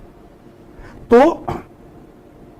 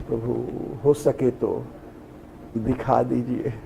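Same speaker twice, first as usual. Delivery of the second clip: soft, low, slow pleading; hushed, reverent request to a lord; humble plea; 6 semitones lower, 11 dB quieter than usual. Acted. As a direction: ask humbly, low and unhurried. Acting Arjun's humble plea to Krishna.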